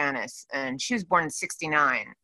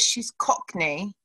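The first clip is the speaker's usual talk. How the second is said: slow, soft mock Cockney; a slow, quiet put-on Cockney; Cockney accent; 5 dB quieter, slower than usual. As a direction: lay on a London Cockney accent. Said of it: "she talks like this".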